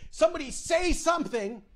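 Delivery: high-pitched imitated plea; panicked woman. Woman gripping headrest begging passengers to speak, high mock panic.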